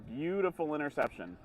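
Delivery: ordinary delivery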